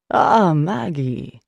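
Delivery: slow, drawn-out warm greeting; a priest's slow warm hello; warmly. A narrator's reading. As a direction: welcome her like an old friend. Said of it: "he says warmly".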